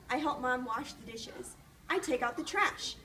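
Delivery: usual speaking voice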